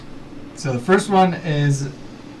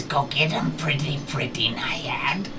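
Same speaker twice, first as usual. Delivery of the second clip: whispered coaxing; 7 dB quieter than usual. Acted. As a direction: coax her quietly. Character urging his pet on.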